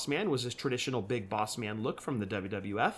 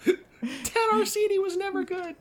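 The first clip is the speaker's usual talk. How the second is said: high slow screech through laughter; shrill screeching bird woman. Acted.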